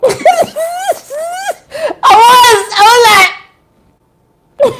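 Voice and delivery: high, loud shrieking laughter breaking through the words; screaming laugh. Real, not acted. Vlogger recalling her mashed-up foot, shrieking laughter. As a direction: cackle and scream while trying to speak.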